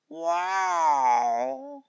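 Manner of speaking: high loud tomcat wow. A narrator's reading. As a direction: answer with a yowl. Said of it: "was the slightly deeper answer".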